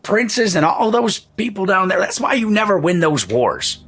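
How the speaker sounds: put-on high, cranky old man's rant; indignant old merchant. Old Tim berates haggling customer, indignant old man voice. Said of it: "he looks to you and he just goes".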